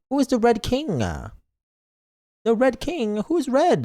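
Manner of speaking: high, mock-worried questions; high puzzled asking; alarmed curiosity. Real, not acted. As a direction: play nervous about the king. Reacting to game line.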